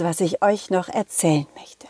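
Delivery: hushed, secretive whisper; softly whispered promise. Acted. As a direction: lure them in with a whisper.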